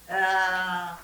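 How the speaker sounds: ordinary talk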